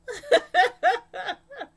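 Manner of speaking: high giggling laugh. Real, not acted. Laughing while asking viewers about Brody. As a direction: let the laugh ride up.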